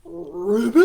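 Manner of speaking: loud, rising frog croak; a little pig imitating a frog; pig croaking ribbit. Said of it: "the pig answered".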